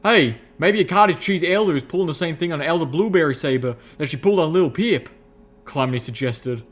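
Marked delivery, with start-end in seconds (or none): 0.0-5.1 loud, high country speculation; Calamity's folksy voice. A narrator's reading. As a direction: toss out a theory, hearty and rustic. Calamity suggests a theory.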